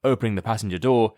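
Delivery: usual speaking voice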